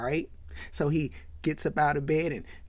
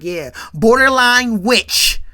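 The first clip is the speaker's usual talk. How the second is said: slow, loud, higher-pitched acted insult; loud jeer; 4 semitones higher, 11 dB louder, slower than usual. Friend piling on as everybody laughs, loud jeer. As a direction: draw the insult out loudly.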